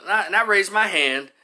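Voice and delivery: ordinary talk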